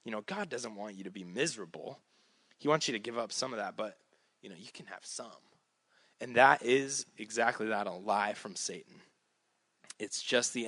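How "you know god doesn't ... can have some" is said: soft, quick, coaxing undertone; imitated whispering tempter. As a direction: murmur it temptingly, like the enemy in someone's ear. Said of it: "the enemy is the one whispering in your ear".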